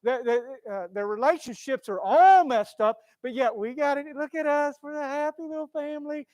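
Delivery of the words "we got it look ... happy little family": louder, higher fake cheerfulness; mock social media boast. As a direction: show off a fake happy life. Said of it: "present something to the world".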